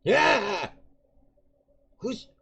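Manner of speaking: put-on high, shrill shout of an old man caught off guard; startled old prisoner. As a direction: yelp like a jumpy old man.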